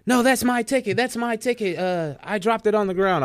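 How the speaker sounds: high imitation of a panicked lady; frantic, panicking. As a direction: play her flustered, voice up high. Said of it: "all frantic and panicking".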